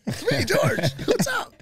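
high goofy voice for George grinning; high mock George greeting. Teller mimics George's cheesy hello in a high voice. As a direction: imitate his grin in a high silly voice. Mocking George's ridiculous smile.

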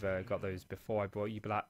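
ordinary delivery

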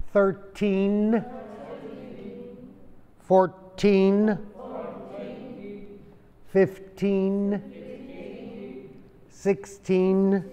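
each number spaced for the class to repeat; drill for repetition. Shown as a demonstration. Teacher leading students in number repetition.

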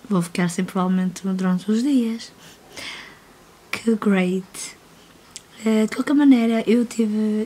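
loud but hoarse; raspy, worn voice from a cold. Real, not acted. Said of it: "continuo rouca".

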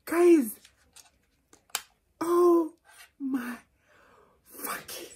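high, loud, near tears; overwhelmed squeal. Real, not acted. Vlogger squealing at viewers over the gift. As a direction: squeal it at the camera.

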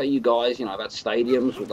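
ordinary delivery

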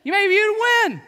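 hammed-up competitive dad yelling; half-playful shouting down a sore loser; retold yell. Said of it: "I just yelled at him".

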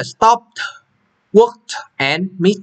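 stop, work, mix read slowly, the final sounds clear; ending sounds. Shown as a demonstration. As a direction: spell out the final consonants slowly. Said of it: "là mình đọc đó là". (đọc chậm rõ âm cuối stop, work, mix)

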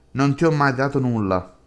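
ordinary delivery

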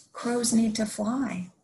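plain reading voice

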